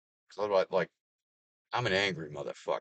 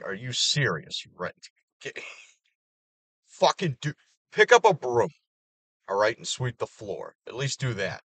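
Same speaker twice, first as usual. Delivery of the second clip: loud, higher exasperated yell at the lazy guy; exasperated scolding; 4 semitones higher, 6 dB louder than usual. Retold or imitated. Yelling at the lazy young coworker, exasperated. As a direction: bark the order at him, fed up. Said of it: "You're just like".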